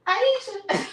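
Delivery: sudden high squeal of recognition, a stranger spotting her; startled, high-pitched "Aisha!" from a passerby; surprised recognition. Retold or imitated. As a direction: act like a fan who just spotted her and squeals her name. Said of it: "I'm like".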